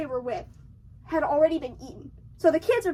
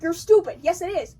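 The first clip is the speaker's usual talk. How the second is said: mock dismissive; 2 semitones higher, 6 dB louder than usual. Mayor argues with Hooper.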